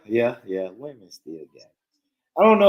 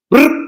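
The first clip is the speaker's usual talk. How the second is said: mock gun burst; 13 semitones higher, 7 dB louder than usual. Shown as a demonstration. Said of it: "imitating gun burst".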